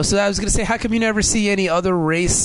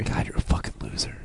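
whisper; 9 dB quieter than usual. Real, not acted. Mock insult whispered.

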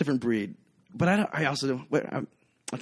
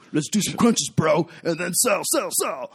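higher, louder bro pitch; gym bro voice; 6 semitones higher, 6 dB louder than usual. Retold or imitated. Bartender mocking corporate gym culture, bro voice. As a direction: pump it up like a gym salesman. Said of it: "everything was really".

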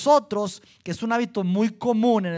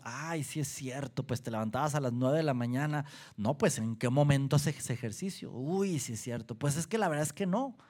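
low, soft pretend conceding; mock agreement; 6 semitones lower, 8 dB quieter than usual. Pretending to believe someone's gym excuse, quiet mock agreement. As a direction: play along in a hushed, low voice.

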